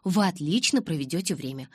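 plain reading voice

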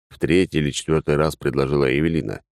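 ordinary delivery

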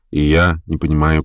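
ordinary delivery, nothing marked

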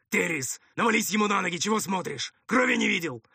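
high, urgent barked orders; irritated commander. Acted. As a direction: drive a hesitant helper on impatiently. Commander snaps orders at helper.

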